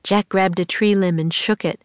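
plain reading voice

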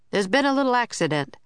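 cheerful. A narrator's reading. Uniformed man reassures worried passengers, cheerful and quick. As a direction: make it sound like nothing serious. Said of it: "said cheerfully".

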